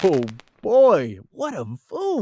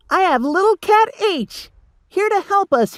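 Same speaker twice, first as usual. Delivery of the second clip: much higher, louder tiny cat; little cat voice; 11 semitones higher, 6 dB louder than usual. Acted. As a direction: squeak it out like a small cat. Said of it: "he said".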